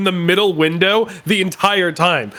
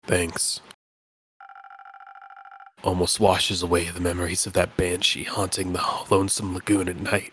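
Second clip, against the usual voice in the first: low spooky voice; 11 semitones lower, 9 dB quieter than usual. Acted. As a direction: sound like a weary ghost. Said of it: "the perfect voice for him".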